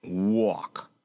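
gruff, unsympathetic goat; growling goat. A narrator's reading. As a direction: growl it curtly. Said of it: "growled Bilbil".

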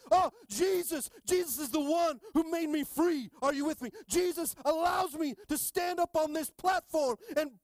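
high, loud shouting sermon; full-force preaching. Acted. Preacher shouting to the congregation about knowing Jesus, full force. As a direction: lift it up and shout his name to the church.

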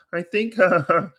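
a lightly voiced Ray Romano boast; Ray Romano bragging. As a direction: laugh through a smug brag as Ray. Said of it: "he's like".

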